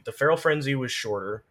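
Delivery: usual speaking voice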